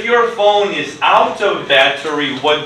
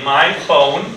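slow dictation; 2 semitones lower than usual. Shown as a demonstration. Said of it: "write it down".

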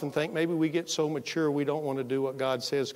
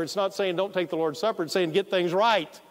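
ordinary talk, nothing marked

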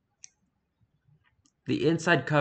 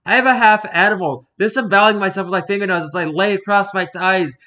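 higher and much louder, building to a desperate wail; frantic rising cry; 8 semitones higher, 9 dB louder than usual. Acted. The bleeding book cries out to the researchers, rising and frantic. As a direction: let the horror pour out louder and louder.

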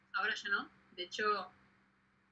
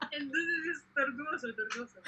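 laughing; 5 semitones higher, slower than usual. Real, not acted. Laughing about her restlessness.

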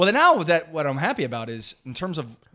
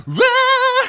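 high, loud jingle yell; punchy show intro cry; jingle shout; 6 dB louder than usual. Acted. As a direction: belt out a cheesy show jingle. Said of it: "a little jingle".

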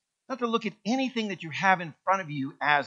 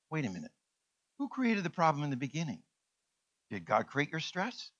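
normal talking voice

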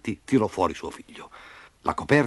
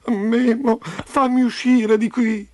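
high, slow crying voice; tearful plea; 12 semitones higher, 3 dB louder, slower than usual. A narrator's reading. Trapped Roby begging Memo to free him, tearful. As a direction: plead as a weeping child, drawing it out. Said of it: "Roby il forte stava piangendo".

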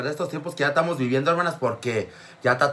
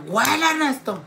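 mother scolding; 13 semitones higher, much slower than usual. Retold or imitated. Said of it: "mother speaking".

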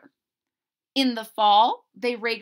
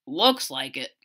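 much lower dry dad voice; deadpan father agreeing; father's voice; 8 semitones lower than usual. Acted. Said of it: "said Chester's father".

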